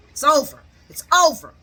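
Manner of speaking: loud, emphatic finality; retold ultimatum. Sonny giving Gladys the one-two, loud mock finality. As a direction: punch each phrase loudly.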